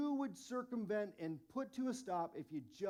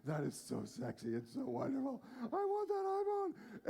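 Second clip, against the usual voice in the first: mock gushing over the phone; 7 semitones lower than usual. Pastor mocks himself drooling over the new iPhone, hammed-up gushing. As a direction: gush over the iPhone like it's a crush. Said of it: "and I'm like".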